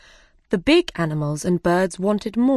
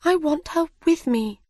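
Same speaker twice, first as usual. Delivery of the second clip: longing Alice; 9 semitones higher than usual. Acted. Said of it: "said Alice".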